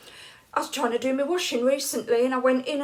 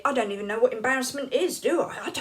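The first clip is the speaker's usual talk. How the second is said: usual speaking voice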